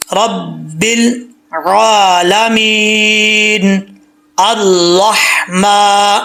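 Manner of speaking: slow, drawn-out chanted recitation; Quranic recitation. Shown as a demonstration. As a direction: recite word by word for the class to follow. Recites Fatiha for students to repeat.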